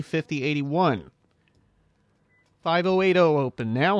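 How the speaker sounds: ordinary talk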